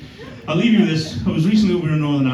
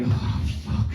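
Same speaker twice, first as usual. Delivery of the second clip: whispered dread; 5 dB quieter than usual. Retold or imitated. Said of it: "I was like".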